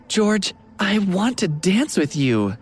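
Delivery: stubborn. A narrator's reading. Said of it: "Natalie said stubbornly".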